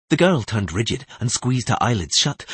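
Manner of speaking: ordinary delivery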